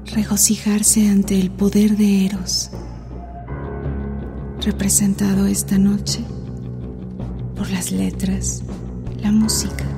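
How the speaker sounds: sensual radio host. Acted. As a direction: close and seductive over music. Sensual host opening over music.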